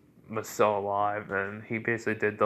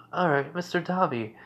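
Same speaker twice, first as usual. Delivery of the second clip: put-on little girl; 7 semitones higher than usual. Little girl voiced in story.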